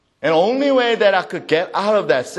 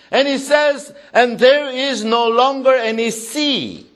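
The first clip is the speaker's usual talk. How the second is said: high-pitched, emphatic Bible reading; scripture proclamation; 7 semitones higher, 5 dB louder than usual. Real, not acted. Preacher reading Second Peter aloud, proclaiming. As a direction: proclaim the verse with sustained force.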